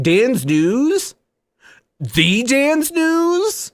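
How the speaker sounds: high-pitched, drawn-out mock disbelief; imitated amazed fan. Gamer recognizing a known streamer, mock astonishment rising high. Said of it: "people are like".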